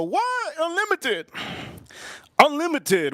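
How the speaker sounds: very high incredulous squeal; mock amazement. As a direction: replay a stunned reaction way up high. Acts out kid's amazed reaction.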